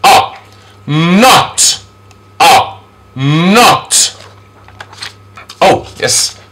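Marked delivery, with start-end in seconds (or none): 0.0-4.2 short O, then Not; vowel demonstration. Shown as a demonstration. Teacher models a vowel, short O and Not separately. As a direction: sound out O alone, then Not. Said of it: "Here's another vowel sound".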